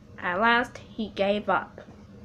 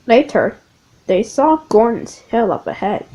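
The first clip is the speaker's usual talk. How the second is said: plain reading voice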